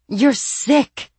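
high, loud snarl of disgust. Acted. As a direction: spit the words at them. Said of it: "the lady snarled".

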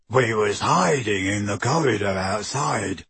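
dull thug voice, grunting; grunted. A narrator's reading. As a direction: Goyle grunting at Harry. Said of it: "grunted Goyle".

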